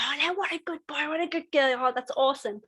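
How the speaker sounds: lavish praise on a good dog; praising the dog. Shown as a demonstration. Owner praising dog for coming back, high gushing praise.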